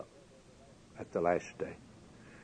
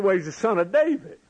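quick, higher, self-assured reply; pitched-up know-it-all scribe; smug Pharisee; 8 semitones higher, faster than usual. Acted. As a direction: toss it off like the obvious answer. Preacher voices the Pharisees' answer.

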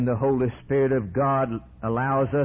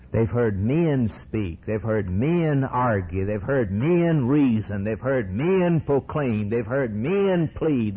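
ordinary delivery

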